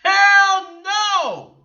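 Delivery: high, full-volume outburst; loud, outraged yell; emphatic shouted denial. Real, not acted. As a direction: belt out an indignant refusal.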